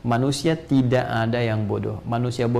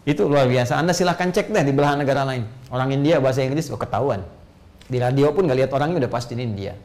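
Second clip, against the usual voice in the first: ordinary talk, nothing marked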